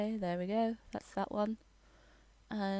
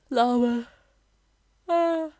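surprised; 6 semitones higher, 11 dB louder, slower than usual. Real, not acted. Startled by a llama.

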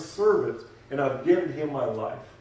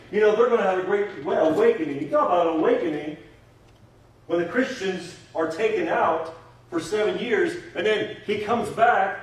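raised, emphatic pulpit voice; fervent preaching; 4 semitones higher, 5 dB louder than usual. Real, not acted. Preacher promising the congregation Israel's revival, fervent. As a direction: preach it with building force, pitch lifted.

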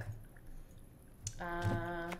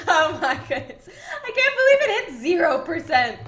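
laughing; 6 semitones higher, 8 dB louder than usual. Real, not acted. Laughing at sanity hitting zero.

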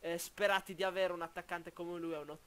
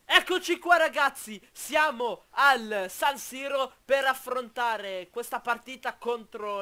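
excited announcing; 5 semitones higher, 11 dB louder, slower than usual. Real, not acted. Welcoming viewers to the match.

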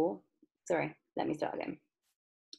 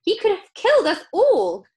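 high, loud horrified accusation; Grandma Josephine screaming; 12 semitones higher, 11 dB louder than usual. A narrator's reading. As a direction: shriek it in outrage. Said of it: "screamed Grandma Josephine".

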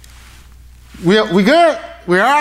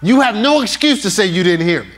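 normal talking voice

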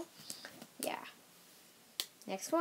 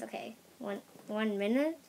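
ordinary delivery

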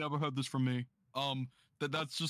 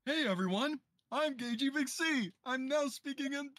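high-pitched put-on voice; Gagey Big C impression; 11 semitones higher than usual. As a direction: introduce yourself in a high character voice. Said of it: "what if he, uh, did the voice".

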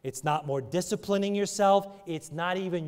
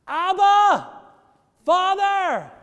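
a high, ringing shout of Abba, Father; loud cry; 12 dB louder, much slower than usual. Acted. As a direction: cry it out with full voice to heaven. Preacher cries out the prayer.

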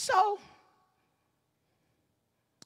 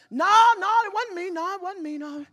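panicked denial; 8 semitones higher, 8 dB louder than usual. Retold or imitated.

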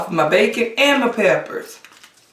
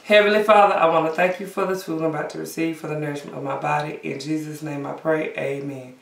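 fast, softening mealtime grace; quick prayer; 4 dB quieter than usual. Real, not acted. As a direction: rattle off a quick grace, trailing quiet. Says grace before eating.

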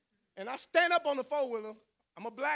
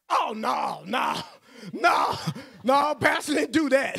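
protesting shout; 2 semitones higher, 9 dB louder, slower than usual. Retold or imitated. Yard crowd protesting the accusation.

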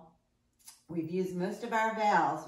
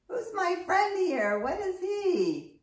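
playful question; 11 semitones higher, 4 dB louder than usual. Acted. Teacher quizzing children, playful.

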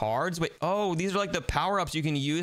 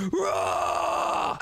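high, drawn-out mock roar; battle roar; 10 semitones higher than usual. Player attacking the giant monster, high mock battle roar. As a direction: roar it high as you charge.

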